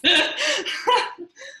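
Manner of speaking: bright burst of laughter; high laugh. Real, not acted. Laughs at her own complaint.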